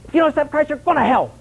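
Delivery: high, blunt outburst at a stranger; frustrated blurt. Retold or imitated. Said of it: "we get so frustrated".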